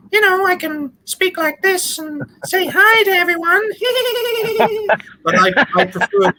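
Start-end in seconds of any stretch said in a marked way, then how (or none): 0.1-3.6 squeaky-high put-on voice; changed voice. Shown as a demonstration. Guest shows host how they can change voices, high put-on voice. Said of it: "I can also change voices". 3.7-5.0 very high, drawn-out character giggle; put-on high giggle. Shown as a demonstration. Guest showing off a changed voice, high put-on giggle. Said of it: "I can also change voices".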